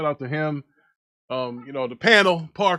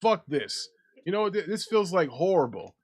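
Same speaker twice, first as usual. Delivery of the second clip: ordinary delivery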